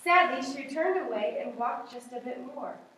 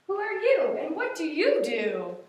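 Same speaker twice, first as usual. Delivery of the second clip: big elephant voice; 4 semitones higher, 5 dB louder than usual. Acted. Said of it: "That was a big one".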